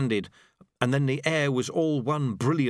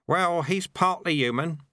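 lightly voiced sweeper, pitched up; reply in a slightly put-on, higher voice; sweeper's mild rejoinder; 5 semitones higher than usual. Sweeper excusing him to Susan, mild higher character voice.

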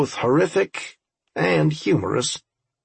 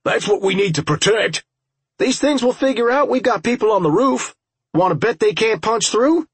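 high, raised voice pressing the point; urgent battle warning; 9 semitones higher, 3 dB louder than usual. Acted. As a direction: call it out urgently over the fight.

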